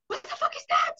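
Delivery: high-pitched incredulous outburst; retold shock. Retelling her shock at the sight.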